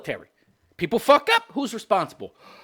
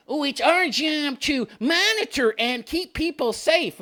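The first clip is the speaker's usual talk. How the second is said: higher, slow mock-pious voice; singsong mockery of an official line; mocking quote; 6 semitones higher than usual. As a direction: parrot it in a high, drawn-out voice. Mocks the company's claim.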